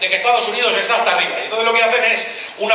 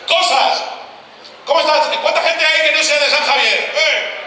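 higher, projected crowd call; 6 semitones higher, slower than usual. Real, not acted. Comic asks who is not from San Javier, calling out. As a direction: throw it up to the back rows.

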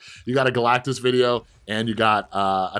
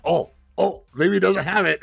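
higher, hopeful mock opponent voice; imagined opponent; 9 semitones higher, 4 dB louder than usual. Imagined opponent hoping the card isn't coming, higher mock voice. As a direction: play the nervous rival hoping it's safe. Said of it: "You know, they're like".

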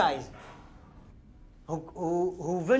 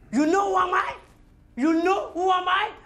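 high, loud challenge; menacing boast; 10 semitones higher, 4 dB louder than usual. Acted. Man confronts someone who called him Pocahontas, high menacing challenge. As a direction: puff yourself up and challenge them, pitch high.

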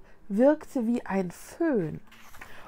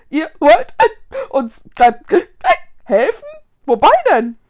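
loud, higher words broken by hiccups; confused hiccuping; 6 semitones higher, 13 dB louder than usual. A narrator's reading. Confused ghost questions children's offer, hiccuping. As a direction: hiccup between words, bewildered. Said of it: "bekam vor lauter Verwirrung einen Schluck auf".

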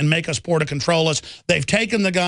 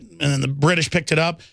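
usual speaking voice

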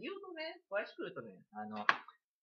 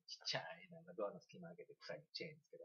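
hushed, breathless whisper; 13 dB quieter than usual. Real, not acted. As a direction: whisper as if peeking through a door. Describing the neighbor peeking quietly. (ささやき声)

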